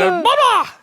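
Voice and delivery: short, loud, high gobble attempt; imitated turkey gobble. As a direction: burst out a brief practice gobble. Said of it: "that's what it sounded like".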